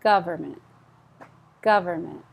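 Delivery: Government with gov stressed and the end dropped. Shown as a demonstration. Teacher modelling government for students, stress on gov. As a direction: hit gov and let the rest fall. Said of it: "Stress on gov".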